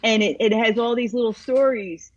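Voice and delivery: ordinary delivery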